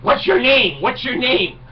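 retelling a man yelling in his face; retold screaming. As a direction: imitate an in-your-face yell. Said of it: "starts screaming".

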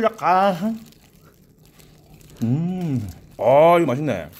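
talking between bites of chicken; muffled chewing speech; mouth full, chewing. Real, not acted. Seen 4: Bites drumstick at -2s, chews with closed lips while speaking.

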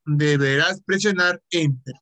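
normal talking voice

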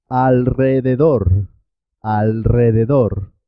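alrededor with R rolled after the L; double R after L. Shown as a demonstration. Teacher models R after L in alrededor, trilled. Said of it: "pero suena doble".

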